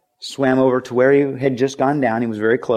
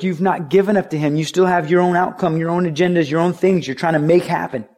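normal talking voice

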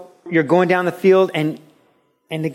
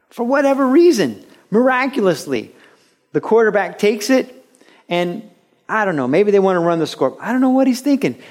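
higher, louder, building thrill; excited play-by-play; 6 semitones higher, 3 dB louder than usual. Acted. Preacher narrating a game-winning play to the congregation, excited. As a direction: call it like a last-second comeback.

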